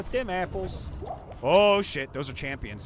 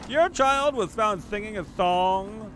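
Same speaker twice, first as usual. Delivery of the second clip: slow, higher prim principal voice; school principal; 4 semitones higher, 3 dB louder, slower than usual. Pretend. Principal calling a parent in to school, slow and prim. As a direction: lay it out slowly like a stern principal.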